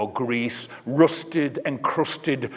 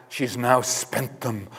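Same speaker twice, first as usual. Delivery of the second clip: plain reading voice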